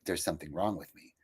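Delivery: low, soft, resigned; hushed hurt self-belief; inner belief. Retold or imitated. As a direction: say it quietly to yourself. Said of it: "how I interpreted it".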